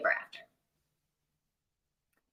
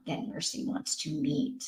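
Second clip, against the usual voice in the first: whispered; 6 semitones lower, 10 dB quieter than usual. Real, not acted. Reading plot under breath.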